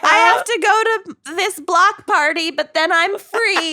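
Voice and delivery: high, slow imitation of Lucy; high imitating voice. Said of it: "Lucy's like".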